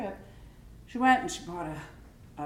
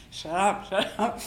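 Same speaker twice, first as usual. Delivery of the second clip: loud retold "shut up"; mock hushing; 6 dB louder than usual. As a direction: hush the brother forcefully, half in fun. Acted hushing of brother at customs.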